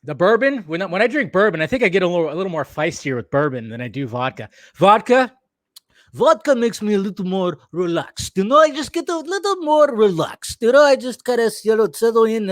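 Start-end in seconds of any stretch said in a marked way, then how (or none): 4.8-12.5 higher-pitched, badly faked Russian accent; shitty Russian accent. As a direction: put on a relaxed, hammy Russian accent. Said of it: "like, a really shitty Russian accent".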